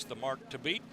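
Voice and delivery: ordinary talk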